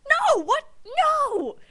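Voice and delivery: loud, very high cartoon yelp; mock outraged denial. Boy denying it to the doctor, loud high mock outrage. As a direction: shriek it in hammed-up horror. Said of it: "And he's like".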